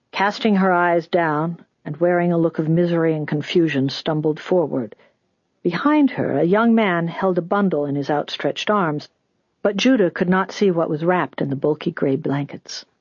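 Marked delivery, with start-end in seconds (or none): none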